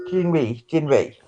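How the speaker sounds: normal talking voice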